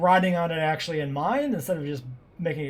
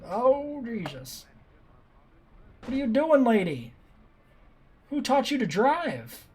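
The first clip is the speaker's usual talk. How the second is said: high, exasperated yelling; road rage yelling; 8 semitones higher, slower than usual. Real, not acted. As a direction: yell at a bad driver. Yelling at jeep driver.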